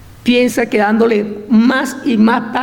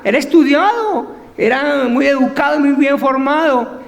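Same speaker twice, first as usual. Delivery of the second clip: ordinary talk, nothing marked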